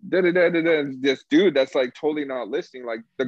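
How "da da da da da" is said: high, fast imitated rant; mock furious rant. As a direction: rattle it off like a furious worker. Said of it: "waving in the air".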